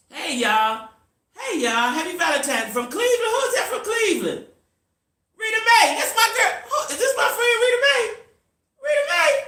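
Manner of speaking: high, loud, laughing greeting; spotting her oldest friend in the chat, delighted; excited. Real, not acted. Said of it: "I got a little excited".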